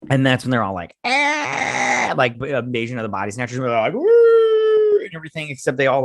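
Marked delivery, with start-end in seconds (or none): 1.0-2.1 high, drawn-out alien screech; weird screech. Retold or imitated. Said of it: "do their weird screeching". 3.9-5.0 very high, loud sustained "woo"; weird screeching. Retold or imitated. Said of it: "do their weird screeching".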